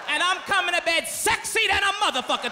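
normal talking voice

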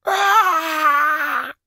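very high, loud wail of grief; anguished scream. A narrator's reading. Sorcerer hears the mask is broken, shrieking in grief. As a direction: shriek like a grieving mother. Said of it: "screamed like the mother of a dead child".

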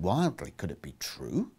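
plain reading voice

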